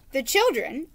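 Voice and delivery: ordinary delivery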